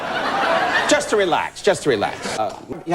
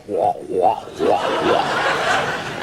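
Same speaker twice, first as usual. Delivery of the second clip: mock crying wah; 12 semitones lower, much slower than usual. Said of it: "Just sitting there going".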